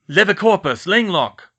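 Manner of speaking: high, loud spell cries; spell shout. Acted. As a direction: snap out spells. Spells in battle.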